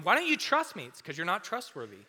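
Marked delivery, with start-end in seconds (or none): 0.0-0.8 high, louder mock-hurt question; high mock-indignant teen; whiny teen. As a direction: plead like an offended kid. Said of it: "and you're going".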